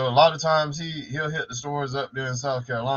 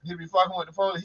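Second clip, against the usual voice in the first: ordinary talk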